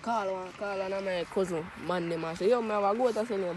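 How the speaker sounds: normal talking voice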